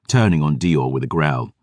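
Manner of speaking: ordinary delivery, nothing marked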